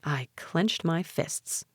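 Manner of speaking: plain reading voice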